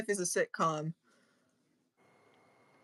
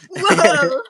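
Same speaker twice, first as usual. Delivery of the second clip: high, louder laughing exclaim; laughing whoa; 9 semitones higher, 8 dB louder than usual. Real, not acted.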